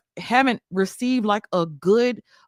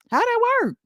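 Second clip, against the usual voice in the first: much higher, pleading disbelief; exasperated question; 11 semitones higher, faster than usual. Real, not acted. Streamer to angry men, baffled and high. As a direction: squeak it out, baffled.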